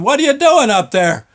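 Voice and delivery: exasperated shout, high and loud; hollering up. Retold or imitated. Foreman Cliff hollers at worker stuck in elevator, loud and annoyed. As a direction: yell up the shaft, fed up. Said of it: "Cliff's hollering".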